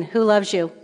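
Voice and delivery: usual speaking voice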